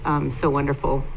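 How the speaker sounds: ordinary delivery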